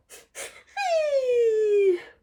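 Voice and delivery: long high wailing laugh; high cry-laugh. Retold or imitated. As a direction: let out a high giddy wail on the gas. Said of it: "I'll be like".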